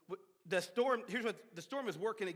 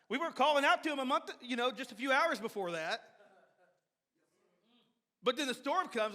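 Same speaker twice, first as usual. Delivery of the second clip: ordinary delivery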